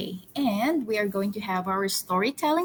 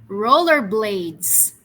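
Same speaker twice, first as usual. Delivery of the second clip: slow model word; 4 dB louder, much slower than usual. Shown as a demonstration. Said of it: "repeat after me".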